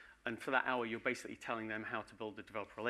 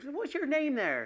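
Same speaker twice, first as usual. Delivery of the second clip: high mock official voice; registrar asking; 6 dB louder, slower than usual. Clerk asks the ancestor his name, high mock voice. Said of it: "they've said".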